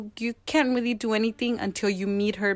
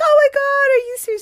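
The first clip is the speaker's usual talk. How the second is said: high, loud re-enacted shock; astonished disbelief; 7 dB louder, faster than usual. Woman told she is chosen as a parent, acted astonishment. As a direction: burst out high, as if hearing unbelievable news. Retold reaction to being chosen.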